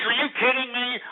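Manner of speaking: ordinary delivery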